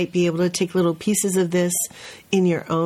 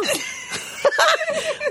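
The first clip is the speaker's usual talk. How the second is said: high burst of laughter. Real, not acted. Teacher laughs at the boy.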